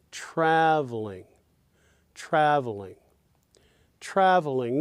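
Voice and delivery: traveling with trav stressed, ol and ling weak. Shown as a demonstration. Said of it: "the first syllable, of course, is stressed".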